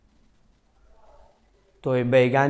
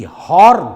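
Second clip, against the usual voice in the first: a much louder, higher honk; mock horn blast; 7 semitones higher, 13 dB louder than usual. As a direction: blare it like a car horn. Imitating a horn. (Horn की नकल, बहुत ज़ोर से)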